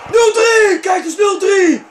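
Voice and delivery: high, loud triumphant cry; goal shout. Real, not acted. Gamer shows off his third goal, high shouting.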